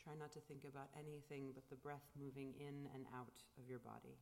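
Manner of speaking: calm, unhurried guiding of the breath; guided meditation. Acted. Leads a silent meditation.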